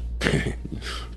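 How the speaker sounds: quiet unvoiced snicker; a soft, knowing snicker; soft breathy chuckle. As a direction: snicker under your breath. Chuckling over company orders.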